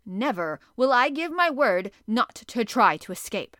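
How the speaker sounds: loud, slow, defiant declaration; defiant shout. A narrator's reading. Harpooner vowing to escape, loud slow defiant shout. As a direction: shout it as an oath, each word slow.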